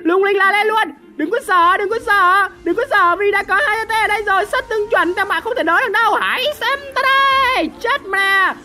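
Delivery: high, hyped hero speech; rally the team with showy confidence; excited gamer champion proclamation; rousing battle boast. Real, not acted.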